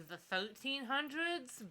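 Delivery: ordinary delivery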